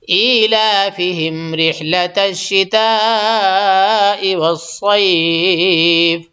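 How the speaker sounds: slow, drawn-out chanted tilawat; tajweed recitation; Quran recitation. Acted. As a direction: recite it melodically, stretching the long vowels. Recites Quran between explanations.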